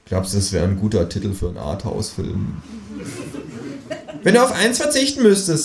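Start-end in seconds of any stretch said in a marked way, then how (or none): 4.2-5.6 high kangaroo question. Acted. Kangaroo ignores the film idea, high voice. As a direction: quiz him as the kangaroo. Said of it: "sagt das Känguru".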